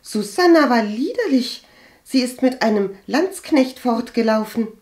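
high, loud, disparaging claim; scandalised insistence. A narrator's reading. Cousin blackens the absent maid's name, scandalised.

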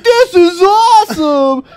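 high, slow, drawn-out whoop; retold thrill. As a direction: stretch it out high, imitating a thrilled rider. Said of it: "He's like".

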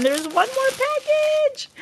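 very high, rising sing-out; excited squeal. Real, not acted.

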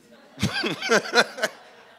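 a high, loud laugh breaking out at his own joke; burst of real laughter. Real, not acted. Speaker laughing at his own joke to the room, a high loud laugh.

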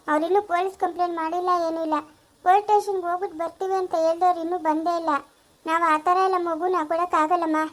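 normal talking voice

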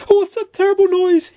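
very high, loud alarmed squeal; panicked cry. A narrator's reading. Mister Jelly woken by a noise, panicked cry. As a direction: cry out in a high, startled character voice. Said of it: "he cried".

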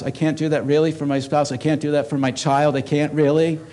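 ordinary delivery

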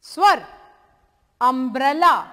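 अम्ब्रेला with a loud short अ start; demo of the vowel. Shown as a demonstration. Teacher models the vowel sound for students. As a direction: stress the opening अ in अम्ब्रेला. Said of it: "तो इसका उच्चारण".

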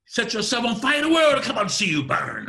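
high, driving shout at full tilt; big, high, rapid-fire delivery; loud and fast. Shown as a demonstration. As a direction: fire it out loud and quick for impact. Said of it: "if I'm loud and fast".

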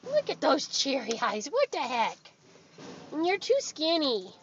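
ordinary talk, nothing marked

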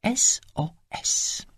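slow whispered spelling S O S. Acted. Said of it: "wyszeptała".

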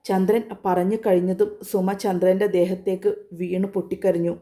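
usual speaking voice

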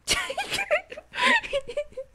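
squealing cackle, very high; high shrieking laugh. Real, not acted. Streamer cackling while bonking the villager, squealing laugh. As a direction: burst into a shrill squeal of a laugh.

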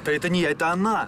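loud defensive shout. Acted. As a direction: yell it back in protest. Lover's quarrel, defensive outburst.

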